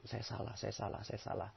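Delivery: very soft murmur to oneself; hushed, repeated admission; humble introspection. Shown as a demonstration. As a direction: quietly search yourself for fault. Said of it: "Jadikan cermin ajaib".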